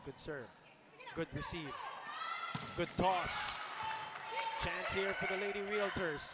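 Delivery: excited call building to a loud shout; live play-by-play. Real, not acted.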